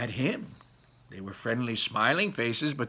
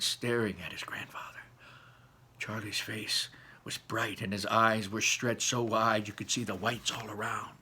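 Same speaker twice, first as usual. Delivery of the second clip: usual speaking voice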